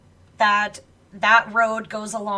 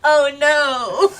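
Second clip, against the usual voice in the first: laughing; 5 semitones higher, 8 dB louder than usual. Real, not acted. Laughing hard.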